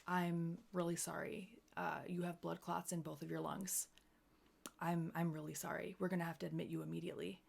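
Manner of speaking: soft, grave bad news delivery; quietly imitating a sorry doctor; somber doctor voice. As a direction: break it gently, low and quiet. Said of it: "That's literally what it sounded like".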